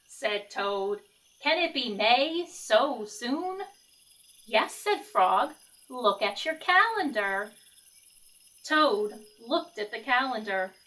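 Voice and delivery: lively picture-book read-aloud; reading to children. A narrator's reading. Teacher reading Frog and Toad to the class, lively storytelling. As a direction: read it like story time for kids.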